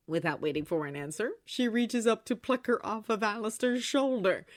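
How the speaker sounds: ordinary delivery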